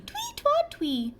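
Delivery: whistled twee. A narrator's reading.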